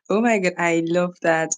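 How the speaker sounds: ordinary talk, nothing marked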